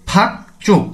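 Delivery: ফাক চোখ with a hard ch, not j; ch for jiut. Shown as a demonstration. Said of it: "তো উচ্চারণটি হবে চ এর মতো".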